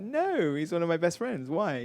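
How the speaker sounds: high startled no, then easing down; surprised denial. Retold or imitated. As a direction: sound caught off guard. Retold own surprised reply.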